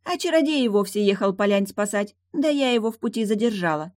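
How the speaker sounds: high-pitched, untroubled mermaid; breezy mermaid. Acted. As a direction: tell it carelessly, pitched high. Mermaid boasts of delaying him.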